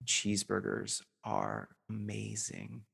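soft, slow and marveling; quiet wonder at something ordinary; hushed awe. Pretend. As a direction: savor every word like a last bite. Savoring an imagined last meal.

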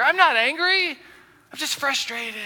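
whiny self-justifying voice; mock defensive denial. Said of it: "that's how we justify our anger".

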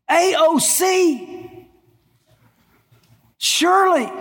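high, rising mock outrage, voice climbing to a shout; mock outraged congregant. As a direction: play the indignant listener who can't believe it. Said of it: "And I know what you're thinking right now".

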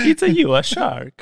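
mock Italian medic. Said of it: "He went".

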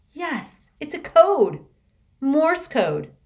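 high, loud burst of discovery; excited realization. Acted. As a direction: it suddenly clicks, exclaim it. Martina realizes the honking is code.

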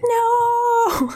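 long, very high mock wail; freaking out. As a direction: drag out a horrified no, hammed up. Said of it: "he kind of freaks out".

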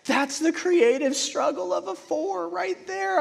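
high, drawn-out mock weepy voice; mock tearful. Preacher mimics himself crying at Chef's Table, mock tearful. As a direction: play yourself overcome, slow and high. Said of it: "I will cry every time I'm like".

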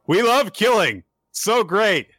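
high, loud gushing cheer; over-the-top enthusiastic fan of it; mock glee. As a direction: exclaim it with hammed-up delight. Voicing killer's gleeful enthusiasm.

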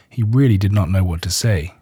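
ordinary delivery, nothing marked

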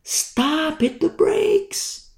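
very high, stretched-out cry, held back in volume; thin, slow, strained mock yell; hushed mock scream. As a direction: pretend to scream with the volume turned down. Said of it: "I'm screaming at the top of my lungs".

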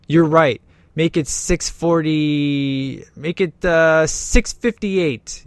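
slow, deliberate dad laying down the law; mock stern dad. Father setting a curfew for the date, slow stern voice. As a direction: drag out the new curfew slowly.

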